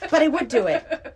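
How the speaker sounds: laughing while quoting; giggling through the line; a real laugh as she quotes. Real, not acted. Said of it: "pathological liar".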